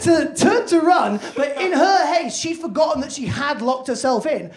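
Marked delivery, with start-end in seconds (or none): none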